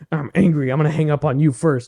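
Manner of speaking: half-laughing mock rage; mock angry. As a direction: play your own fury as a joke. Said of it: "I was like".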